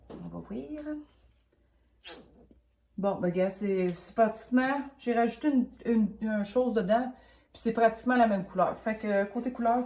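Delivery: usual speaking voice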